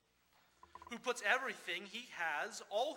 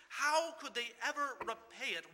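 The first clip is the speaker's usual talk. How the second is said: normal talking voice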